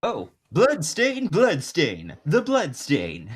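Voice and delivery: smooth jazz musician drawl, stretched slow. Acted. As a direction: croon the name like a jazz man. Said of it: "go a bit more, like, jazz musician".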